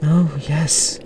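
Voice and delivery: mildly fearful aunt; frightened. A narrator's reading. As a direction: agree meekly, a touch scared. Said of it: "a fright in her pale eyes".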